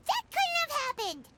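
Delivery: spring the trap, sure you caught him; triumphant accusation. Acted. Paimon exposes the alibi lie.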